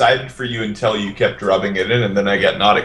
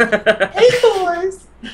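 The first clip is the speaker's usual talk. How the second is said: very high falsetto come-on; mock female voice; much slower than usual. Player shows off to the table, mock female falsetto. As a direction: call out in a put-on girly falsetto. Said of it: "You can do a female voice".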